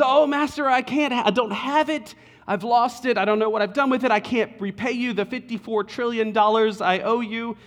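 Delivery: high, pleading servant voice; frantic plea to the master; desperate begging. Acted. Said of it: "falls down ... and begs his forgiveness".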